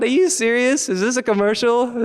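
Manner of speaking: high, loud disbelief; incredulous. Acted. Storyteller reacts to the man's ad-like line, loud incredulous questions. Acts out his astonished thought.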